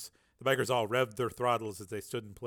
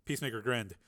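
ordinary delivery

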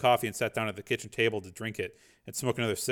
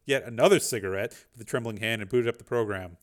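plain reading voice

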